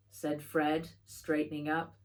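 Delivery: ordinary delivery, nothing marked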